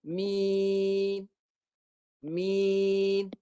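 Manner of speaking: "Min" with the nun cut off silent, no nasal hum; teacher showing the wrong dead nun; nun without ghunnah. Shown as a demonstration. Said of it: "suara senyap terus".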